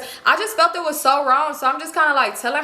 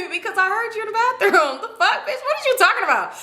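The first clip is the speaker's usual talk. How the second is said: high-pitched incredulous confrontation; mock outrage; 10 semitones higher than usual. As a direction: call out the lie, pitched way up with some laughter. Acted quarrel, confronting friend's denial.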